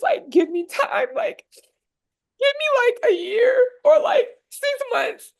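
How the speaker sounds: high, cracking voice, close to sobbing; tearful, voice breaking. Real, not acted. Recalling the plea to her doubting dad, choking up with tears. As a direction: fight back tears on every word. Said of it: "it's making me emotional".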